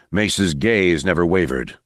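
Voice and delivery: usual speaking voice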